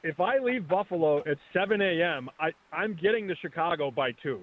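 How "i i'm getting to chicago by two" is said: usual speaking voice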